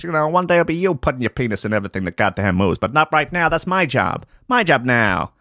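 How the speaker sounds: high-pitched, pushy New York mogul patter; showbiz mogul. Acted. Mogul wooing a newcomer with stardom, high New York hustler patter. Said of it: "showbiz mogul".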